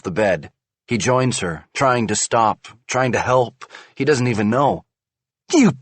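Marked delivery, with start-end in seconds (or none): 5.5-5.7 high, loud bitter insult. A narrator's reading. Wife insults husband, bitter. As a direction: spit it out bitterly. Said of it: "she says bitterly".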